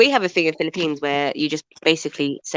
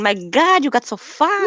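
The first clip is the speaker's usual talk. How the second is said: put-on higher, exclaiming Filipino auntie accent; 8 semitones higher, 3 dB louder, slower than usual. Auntie seeing her niece heavier, Filipino accent. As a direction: mimic an appalled Filipino auntie. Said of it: "I cannot win with Filipino aunties".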